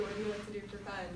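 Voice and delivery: usual speaking voice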